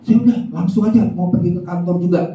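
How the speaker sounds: high, curt angry retort; angry wife tells husband to just go, high and curt. Acted. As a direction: throw it back at him sharply. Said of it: "Istrinya marah".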